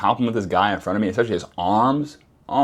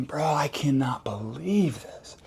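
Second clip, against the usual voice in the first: slow breathless whisper; inner whisper; 4 semitones higher, 8 dB quieter, slower than usual. Retold or imitated. His thoughts about the woman, slow whisper. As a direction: hiss it to yourself in disbelief.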